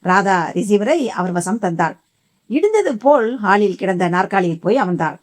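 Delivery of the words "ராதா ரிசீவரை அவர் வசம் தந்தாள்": ordinary delivery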